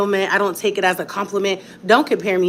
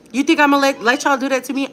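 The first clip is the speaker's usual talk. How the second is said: defiant challenge; 6 semitones higher, faster than usual. Real, not acted. Defiant rant at haters.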